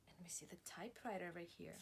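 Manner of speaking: hushed whisper to the class. Real, not acted. Teacher whispering to children about the typewriter. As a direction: lean in and whisper it.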